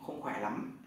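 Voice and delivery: usual speaking voice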